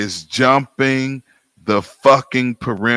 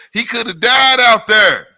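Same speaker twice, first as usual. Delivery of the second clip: heated yell; 10 semitones higher, 7 dB louder than usual. Real, not acted. Ranting about the barricade jump.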